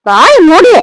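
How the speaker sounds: loud, sharp command; angry hushing. Acted. Mother to wailing baby, angry hushing. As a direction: yell it, patience gone.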